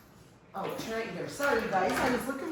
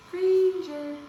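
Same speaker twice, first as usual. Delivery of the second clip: calling out; 9 semitones higher, 6 dB louder than usual. Real, not acted. Calling the toddler's name.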